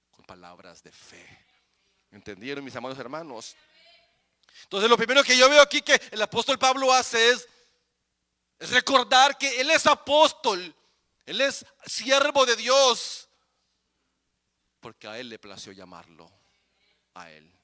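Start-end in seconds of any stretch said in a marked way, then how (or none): 4.6-13.3 loud, rising sermon cry; preaching at full force. Acted. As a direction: proclaim it to the congregation. Preacher rises again. (predicando a todo pulmón)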